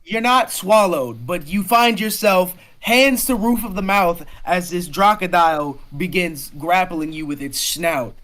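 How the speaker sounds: excited, projected storytelling; dramatic action narration. Acted. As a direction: narrate the grapple with full force. Game master narrates the monster's attack.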